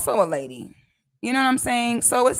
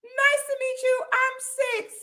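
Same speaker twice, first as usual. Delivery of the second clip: high bright self-quote. Retold or imitated. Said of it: "Like, I'm like".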